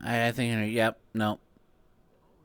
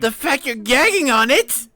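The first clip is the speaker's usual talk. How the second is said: loud; 8 semitones higher, 9 dB louder than usual. Real, not acted. Yelling at drinker.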